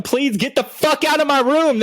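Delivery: high, pleading mock whine; begging. Begging partygoers to leave his room, high whine. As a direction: beg them to leave, high and whiny. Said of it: "I was begging them".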